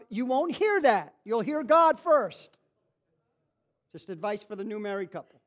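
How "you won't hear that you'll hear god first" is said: slow emphatic. Real, not acted.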